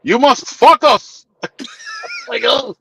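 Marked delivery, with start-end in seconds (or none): 0.0-1.2 slow, higher, imitated heavy Greek accent; slow serious Greek-accented advice. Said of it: "he would say it like this". 1.4-2.2 sudden squeaky laugh; high laugh; high burst of laughter. Real, not acted. Laughing at own impression.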